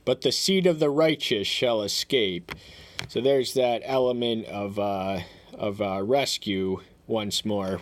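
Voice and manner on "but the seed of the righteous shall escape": higher, louder, solemn proclamation of the verse; scripture reading. Acted. Reads scripture aloud.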